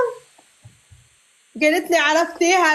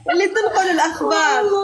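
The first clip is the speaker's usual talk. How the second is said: high, long laughter; laughing; 9 semitones higher than usual. Real, not acted. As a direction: laugh through اللي تنقل الأخبار. Laughs at her own joke.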